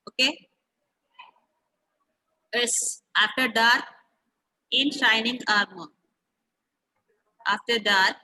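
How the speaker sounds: usual speaking voice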